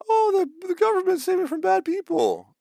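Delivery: very high falsetto, loud; mocking, sky-high grateful citizen voice; mock gullible gratitude. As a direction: squeal it like a gushing simpleton.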